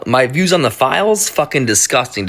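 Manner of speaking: ordinary delivery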